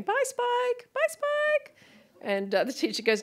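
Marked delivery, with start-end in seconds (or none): 0.0-1.8 very high singsong farewell; pre-K classmates. Retold or imitated. Classmates calling goodbye at pre-K, high kiddie voices. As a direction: imitate little kids chirping goodbye. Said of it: "everyone's going".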